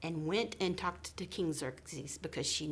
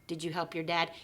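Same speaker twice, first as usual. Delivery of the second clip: usual speaking voice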